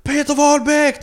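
high-pitched announcer's call; excited stage introduction. Retold or imitated. Comic onstage presents the late-arriving star, excited introduction. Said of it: "presenterar honom och tar på sig den".

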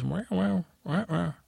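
high-pitched meowed interjection; cat's high wailing wow; talking cat. Acted. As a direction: yowl it like a cat cutting in. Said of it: "And Sir Pounce says".

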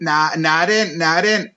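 Geordie accent. Shown as a demonstration. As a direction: try out a Geordie accent on request. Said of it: "Can you do a Geordie accent".

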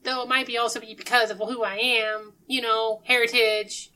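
cheerful odd character. Acted. Game master as Sushi Kid reassuring players, cheerfully odd.

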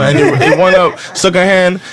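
ordinary talk, nothing marked

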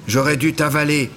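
high, loud menacing creature voice; angry vouivre. A narrator's reading. Vouivre threatening Élie, loud and high. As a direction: threaten him, then hold back.

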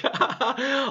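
high, real laugh; burst of laughter. Real, not acted. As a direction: crack up at the story. Host cracks up at story.

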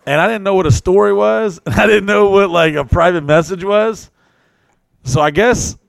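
loud, high excited telling. Real, not acted. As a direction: tell it loud and thrilled.

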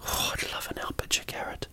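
breathy longing whisper. Pretend. Comic host whispering his wish for the carrot.

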